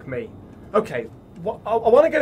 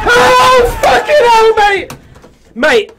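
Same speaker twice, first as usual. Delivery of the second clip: real jump-scare shriek, very high and loud; terrified scream; 14 dB louder, much slower than usual. Real, not acted. Player startled by a horror game, screaming in fright. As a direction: scream it out in sudden shock.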